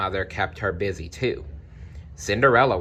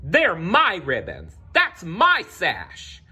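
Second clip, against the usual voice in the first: much higher, slow shrill outcry; stepsisters shrieking; 9 semitones higher, slower than usual. Acted. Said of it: "The stepsisters shrieked".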